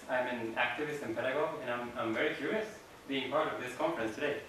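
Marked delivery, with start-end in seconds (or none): none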